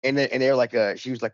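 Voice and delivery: ordinary talk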